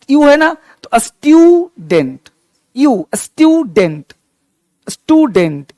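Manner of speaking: स्टूडेंट said slowly with a clear "yoo"; slow pronunciation demo. Shown as a demonstration. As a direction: stretch the यू in स्टूडेंट as स्ट्यूडेंट. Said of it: "यू पढ़ेंगे इसको". (स्टूडेंट धीरे से, यू को खींचकर)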